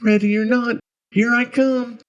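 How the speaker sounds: little voice calling out, high and loud; high small-boy call; Tommy. A narrator's reading. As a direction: sing out like a child playing hide-and-seek. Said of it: "Tommy's little voice counting down".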